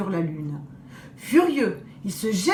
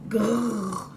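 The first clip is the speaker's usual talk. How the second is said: impatient growl. Acted. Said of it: "le loup s'impatiente".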